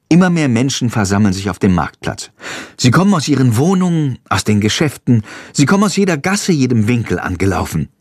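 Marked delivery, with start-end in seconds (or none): none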